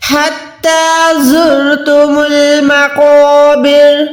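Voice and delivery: زرتم with a hissing, whistled za; slow recitation with sharp za. Shown as a demonstration. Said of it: "زا کو ادا کریں تو وسل یعنی سیٹی کی آواز".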